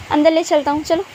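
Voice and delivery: ordinary delivery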